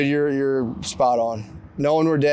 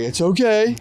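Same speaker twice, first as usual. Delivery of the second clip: reassuring; 8 semitones higher, 4 dB louder than usual. Retold or imitated. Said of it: "you have to be like".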